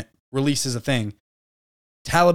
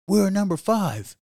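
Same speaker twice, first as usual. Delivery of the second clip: a bragger's chant cut down to a modest claim, voiced higher and slower; hypothetical America owning up to fifth place, higher-pitched and slower; mock humble; 6 semitones higher, slower than usual.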